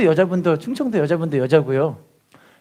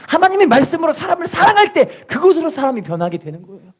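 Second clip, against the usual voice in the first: raised, insistent sermon voice; fervent preaching; 7 semitones higher, 5 dB louder than usual. Acted. Pastor exhorting the church, fervent preaching. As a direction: drive the point home to the congregation.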